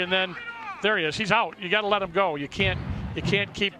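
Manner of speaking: ordinary talk, nothing marked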